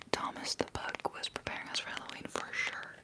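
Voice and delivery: ordinary talk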